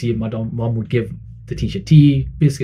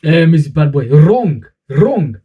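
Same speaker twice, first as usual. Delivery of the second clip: a sharp mock-teacher correction, a touch louder; teacher scolding; 4 semitones higher, 5 dB louder, much slower than usual. Teacher telling off a pupil for a wrong word, mock-stern. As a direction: bark the mistake at the pupil. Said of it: "Let me show you".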